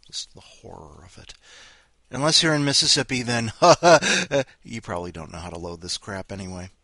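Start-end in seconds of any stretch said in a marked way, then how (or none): 3.6-4.4 loud high laugh that falls away; burst of laughter. Real, not acted. Host cracks up at his own Mississippi joke, laughing loudly.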